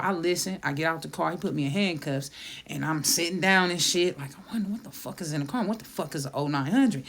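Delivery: ordinary talk, nothing marked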